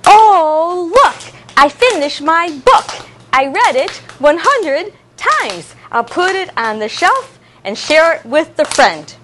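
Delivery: put-on teacher chanting to clapping children; rhyme recital. Shown as a demonstration. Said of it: "reciting reuse rhyme".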